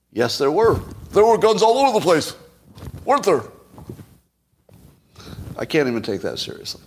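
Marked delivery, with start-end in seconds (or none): 0.1-3.4 high, loud sarcastic reply; mock agreement. Shown as a demonstration. Answering critics with high, loud sarcastic agreement. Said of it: "that's how you should respond".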